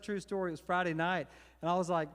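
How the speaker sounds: usual speaking voice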